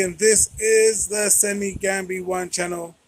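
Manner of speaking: ordinary delivery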